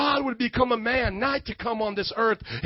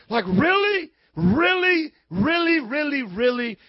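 incredulous doubter; 3 semitones higher than usual. Acted. Preacher voices person hearing about the cross, incredulous. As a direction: act out someone who can't believe it.

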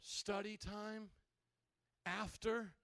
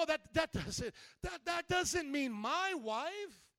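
high, drawn-out stammering doubt; stammer, then stretch the doubtful question; disbelieving protest; 6 semitones higher, 3 dB louder, slower than usual. Acted. Believer protesting Jesus's hard saying.

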